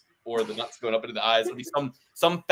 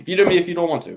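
louder, higher, daring him to veto; loud dare; 6 semitones higher, 6 dB louder, faster than usual. Real, not acted. To his co-host, a loud dare to veto. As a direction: throw down the challenge loudly.

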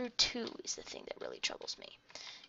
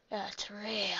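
soft aside to the camera; whispered aside; 5 semitones lower, 9 dB quieter than usual. Real, not acted. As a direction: let it slip under the breath.

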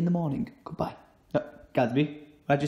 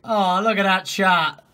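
ordinary talk, nothing marked

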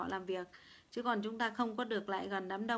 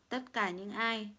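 usual speaking voice

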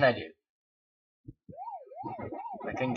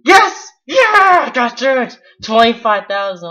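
loud triumphant cheering; 9 semitones higher, 16 dB louder than usual. Real, not acted. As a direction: shout the win out. Got through, cheering score.

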